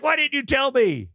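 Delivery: high, loud startled question; sudden shock, voice jumping up high; alarmed dad. Retold or imitated. Father finding his son's finger cut off, high alarmed cry.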